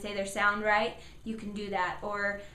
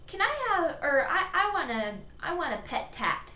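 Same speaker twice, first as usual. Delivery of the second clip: put-on a child's higher voice asking for a cat; 6 semitones higher than usual. Child asking mom for a cat, higher. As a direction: kid asking mom, pitched up a lot. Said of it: "So if they say".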